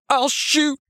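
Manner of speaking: high, showy coward's threat; over-the-top panic. A narrator's reading. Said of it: "The panic in his voice was over the top".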